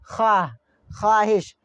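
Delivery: ख्वाह, ख्वाहिश as khaah, khaahish; silent waw. Shown as a demonstration. Teacher listing examples for learners, waw left silent. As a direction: drop the w in ख्वाह, ख्वाहिश, falling tune. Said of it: "आवाज़ नहीं निकल रही है".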